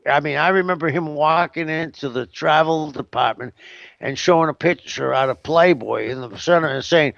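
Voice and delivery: ordinary delivery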